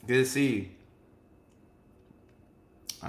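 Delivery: usual speaking voice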